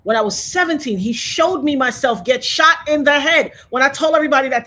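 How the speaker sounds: usual speaking voice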